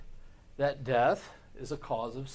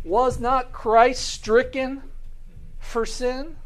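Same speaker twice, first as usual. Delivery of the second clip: higher, louder and slow, every word weighed; forceful preaching; 8 semitones higher, 5 dB louder, slower than usual. Real, not acted. Preacher pressing the congregation about Christ stricken, slow and forceful. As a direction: drive the question home from the pulpit. Preacher's climactic rhetorical question.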